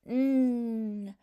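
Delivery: "n" as a long held nnn hum, not the letter name; sounded out. Shown as a demonstration. Teacher giving the last sound in evergreen, sounded out long. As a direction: hold the bare consonant "n". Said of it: "practice sounding out".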